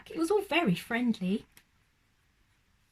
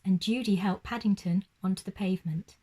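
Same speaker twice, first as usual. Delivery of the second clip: usual speaking voice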